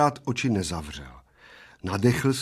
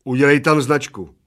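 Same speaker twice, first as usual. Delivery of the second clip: loud, higher order; commanding; 5 semitones higher, 10 dB louder than usual. A narrator's reading. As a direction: give a firm, loud order. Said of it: "poručil Plešatec".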